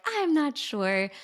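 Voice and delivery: high, drawn-out uncertainty; mock reluctance, stretched out; hesitant. Would-be client unsure about booking, hesitant.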